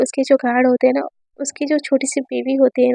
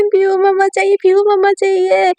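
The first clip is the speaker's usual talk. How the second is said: put-on higher tearful child's whine; child crying; 6 semitones higher than usual. Little Raha starts crying again for mama, tearful whine. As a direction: cry for mama like a little girl. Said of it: "रोने लगती".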